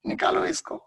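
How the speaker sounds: high-pitched dismissive cry; mock rejection. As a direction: pretend you want it taken away at once. Acting disgust at separated body parts.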